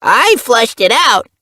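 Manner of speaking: high, loud, slow boast; proud kitten. A narrator's reading. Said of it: "he announced proudly".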